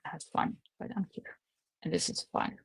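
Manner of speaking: whispered dry aside to herself; sarcastic whisper. Real, not acted. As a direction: breathe it out as an ironic little whisper. Sarcastic aside about working home.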